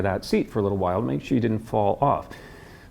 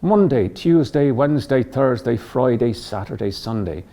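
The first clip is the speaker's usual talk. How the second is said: Dublin accent, lips relaxed; Irish accent. Shown as a demonstration. Coach demonstrating the mouth shape on weekdays, Irish accent. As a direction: list it in an Irish accent, falling away. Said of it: "well on our way to getting that Irish dialect".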